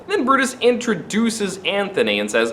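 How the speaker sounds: ordinary talk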